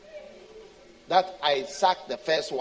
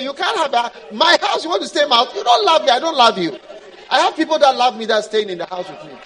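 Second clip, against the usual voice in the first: high, loud scornful refusal; mock them as you turn them away; scornful God; 5 semitones higher, 10 dB louder, faster than usual. God scornfully refusing the believer a house.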